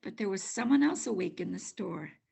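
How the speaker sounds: ordinary delivery, nothing marked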